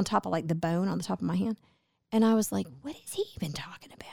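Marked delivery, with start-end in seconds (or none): 2.8-4.1 hushed, quick aside of disbelief; baffled whisper. Retold or imitated. Customer baffled by a stranger's remark, retold in a whisper. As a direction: whisper it like a private thought.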